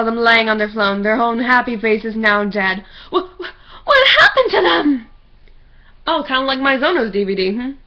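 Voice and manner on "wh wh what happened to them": high, shaky, gasping horror; horrified. Acted. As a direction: stammer out in horror. Horror at dead friends.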